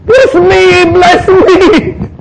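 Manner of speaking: very high, loud begging cry; pleading. Acted. Esau begging his father for a blessing, high desperate plea. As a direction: beg desperately like a wronged son.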